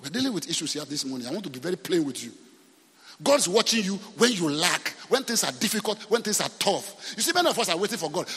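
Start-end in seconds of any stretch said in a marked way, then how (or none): none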